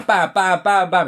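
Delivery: punchy spoken bam bam gun noises; mock gunshots. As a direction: fire off the bams like shots. Imitating gunshots.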